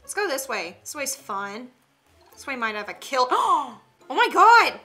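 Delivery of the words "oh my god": high, loud startled cry; shocked gasp. Real, not acted. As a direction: react to the body in real shock. Finds a dead body.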